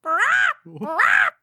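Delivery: high mock bird squawk; silly imitation of a peacock call. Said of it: "Do a real peacock".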